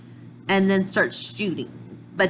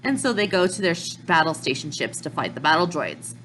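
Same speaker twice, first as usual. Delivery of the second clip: ordinary talk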